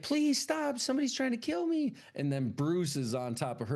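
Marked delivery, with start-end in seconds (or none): 0.0-1.9 much higher, fast mock panic; mock pleading. Woman begging Bruce to stop, high mock panic. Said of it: "And she's like".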